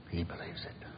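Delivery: soft whispered echo; hushed repeat for emphasis. Real, not acted. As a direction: let it sink in with a whisper. Echoing the quote, hushed.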